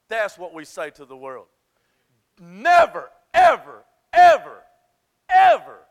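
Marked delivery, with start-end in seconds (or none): 2.4-5.8 each word shouted on its own; real preacher's shout, punched word by word; emphatic shout. Real, not acted. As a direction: hammer every word at full volume. Preacher hammers a command.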